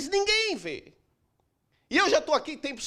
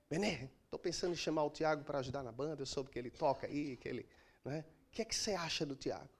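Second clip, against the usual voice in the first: much softer, lower and quicker, almost under the breath; soft confiding question; 9 semitones lower, 13 dB quieter, faster than usual. Retold or imitated. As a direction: ask it quietly, like a private aside. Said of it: "Aí eu pergunto ao Benê aqui". (pergunta baixinha e confidencial)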